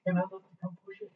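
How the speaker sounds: high playful teasing. Retold or imitated. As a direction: needle her about guilt with a grin. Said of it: "I said".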